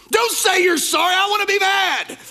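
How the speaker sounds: high, loud indignant shout; a hammed-up tantrum; mock outraged yell. Spouse who wants to stay mad, mock shouting.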